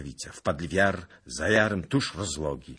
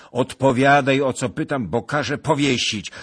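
loud, raised, commanding voice; stern threat; 9 semitones higher, 11 dB louder than usual. A narrator's reading. Lord threatens an evasive peasant with hanging, angrily. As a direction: order him to answer, hard and loud.

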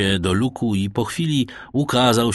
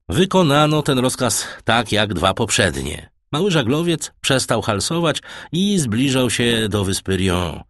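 ordinary delivery

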